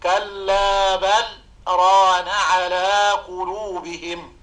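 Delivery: slow Quranic recitation with a gentle stop after بل; light pause after بل. Shown as a demonstration. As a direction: pause briefly after بل without a breath, then ران. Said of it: "سكتة لطيفة بدون تنفس".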